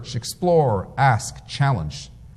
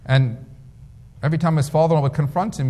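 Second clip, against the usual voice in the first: usual speaking voice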